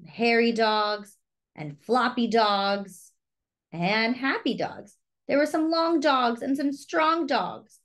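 usual speaking voice

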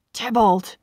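a contained angry cry. A narrator's reading. Enraged Romeo calls out his friend's killer, crying out. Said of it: "he cried".

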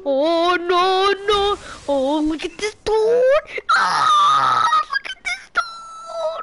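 loud, drawn-out mock horror, climbing high; mock meme wail. Mock meme catchphrase to provoke laughter.

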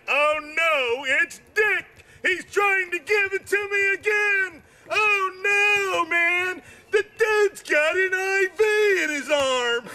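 high, alarmed rant; hammed-up panicked rant; mock panic. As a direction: blurt it in a panic. Retold own panic.

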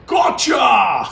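long, loud drawn-out crow; triumphant shout. Pretend. Commentator celebrates a kill, shouting in triumph.

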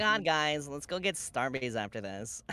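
soft, lightly voiced Valley Girl. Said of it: "do your best Valley Girl impressions".

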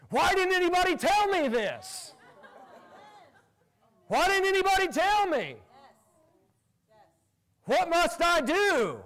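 amazed outcry. Retold or imitated. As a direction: imitate a stranger suddenly overwhelmed. Stranger's amazed plea retold.

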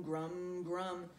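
ordinary delivery, nothing marked

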